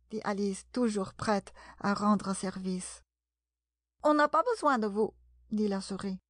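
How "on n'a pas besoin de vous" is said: quick, high, indignant snub; offended rebuff. A narrator's reading. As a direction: snub them fast, voice pitched up. Offended mouse rebuffs Alice. (rebuffade indignée, vive et aiguë)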